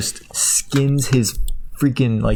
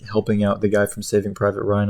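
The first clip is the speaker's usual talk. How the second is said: normal talking voice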